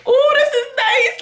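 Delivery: high swooping ooh of delight; squealing ooh at a cute video; gushing coo. Retold or imitated. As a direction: coo over something sweet, way up high. Watching engagement videos, gushing.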